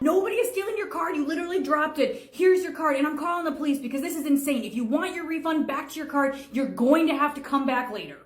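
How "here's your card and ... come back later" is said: ordinary talk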